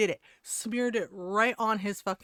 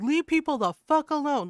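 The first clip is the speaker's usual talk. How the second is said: loud, raised-pitch telling-off; imitated yelling; 5 semitones higher, 6 dB louder than usual. Woman telling off a prankster, imitated yell. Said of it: "Like, she yelled at him".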